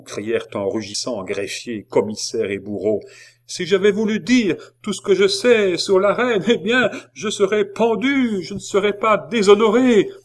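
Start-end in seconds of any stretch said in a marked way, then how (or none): 3.5-10.1 high, loud, sustained tirade; impassioned scream to the whole square; desperate outburst. A narrator's reading. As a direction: shout it over those silencing you. Prisoner shouts over officials silencing her.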